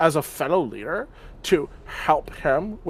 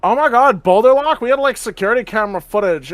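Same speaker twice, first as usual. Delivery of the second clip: loud, high excited outburst; 7 semitones higher, 8 dB louder, faster than usual. Acted. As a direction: blurt it out all thrilled. Dumpy bursts out at artifact question.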